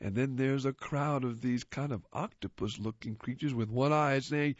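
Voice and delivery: plain reading voice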